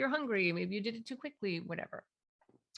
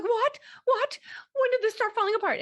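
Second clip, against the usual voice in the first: much higher, louder, fast panicked questions; fear-based panic; 7 dB louder than usual. Shown as a demonstration. Parent panicking over the broken build, loud and high. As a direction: fire them off in alarm. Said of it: "that's, that's fear-based".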